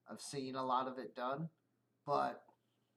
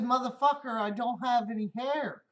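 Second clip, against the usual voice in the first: loud, higher, exasperated yell; mock outraged retort; 8 semitones higher, 9 dB louder than usual. Bald driver yells at coworker over the barber tip, loud and exasperated. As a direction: yell it in disbelief, half laughing. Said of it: "I said".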